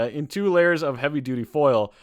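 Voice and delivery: normal talking voice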